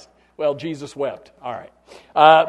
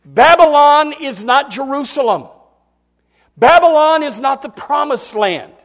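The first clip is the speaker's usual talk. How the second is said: proclaiming; 7 semitones higher, 10 dB louder than usual. Real, not acted. Preacher proclaims contrast.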